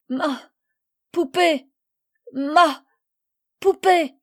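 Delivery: high, loud broken words between sobs; choked sobbing. A narrator's reading. Little girl sobbing to her mothers about her doll. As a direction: gasp out each word through tears. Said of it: "Les larmes la suffoquaient".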